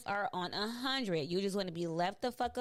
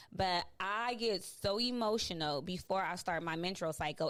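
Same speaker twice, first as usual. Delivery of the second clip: normal talking voice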